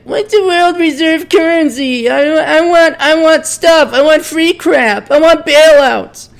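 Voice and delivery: entitled millennial demanding free crap, high whine; whiny millennial. Retold or imitated. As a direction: whine like a spoiled kid wanting free stuff. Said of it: "And then the millennials like".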